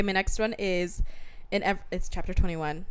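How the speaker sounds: ordinary talk, nothing marked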